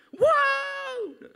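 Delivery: screaming wow high and loud; hammed-up roller coaster scream; crowd shout. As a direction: yell like a terrified rider. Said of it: "Histeris semua".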